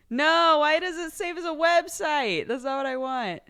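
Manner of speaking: high, loud frustrated whine; exasperated. Real, not acted. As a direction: complain at the computer. Annoyed at computer.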